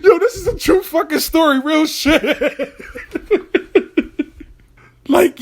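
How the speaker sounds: high, laughing through the words; laughing real. Real, not acted. Host laughing as he swears the story is real. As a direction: crack up while insisting it's true. Teller cracks up about story.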